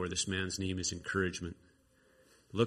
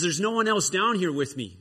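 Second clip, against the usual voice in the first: loud, high-pitched wail; discouraged protest; 8 semitones higher, 7 dB louder than usual. Acted. Discouraged Josiah answering from down in the valley, protesting loudly. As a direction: complain upward, loud and high. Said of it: "He's so discouraged".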